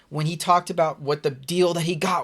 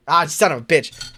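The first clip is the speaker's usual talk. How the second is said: frustrated outburst; 9 dB louder than usual. Real, not acted. Sudden frustration while reading.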